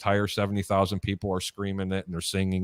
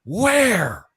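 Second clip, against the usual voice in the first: high, loud crowd cry of where; crowd yelling where; 9 semitones higher, 5 dB louder than usual. Retold or imitated. The stadium crowd shouting back, high and loud. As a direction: call out like a whole stadium asking. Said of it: "All seventy thousand people go".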